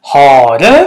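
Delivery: loud call, drawn out; shout. A narrator's reading. Hyena calling the thief out of the cave, shouting. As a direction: call out loudly at someone hidden.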